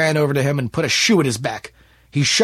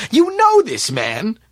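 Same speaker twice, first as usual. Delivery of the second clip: high, loud, drawn-out disbelief; surprised question; 10 semitones higher, 7 dB louder, slower than usual. Acted. Smith turning to April, loudly surprised. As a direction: question her slowly with raised voice.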